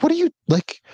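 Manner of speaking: high-pitched mock exasperation; retold indignant protest. Son retelling his complaint to mom, high-pitched exasperation.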